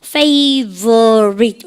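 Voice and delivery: FAVORITE very slow, each syllable held long; sounded out for spelling. Shown as a demonstration. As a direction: stretch "favorite" into fa-vo-rite, every part clear. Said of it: "스펠링 어떻게 합니까".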